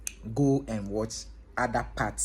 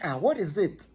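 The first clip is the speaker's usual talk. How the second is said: startled question; 7 semitones higher than usual. Retold or imitated. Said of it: "I was like".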